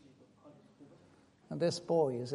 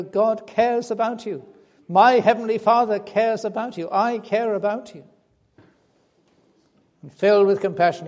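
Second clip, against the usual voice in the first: louder, impassioned sermon voice; fervent preaching; 3 semitones higher, 9 dB louder than usual. Acted. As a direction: preach it out to the congregation. Fervent preaching, Jesus quoted.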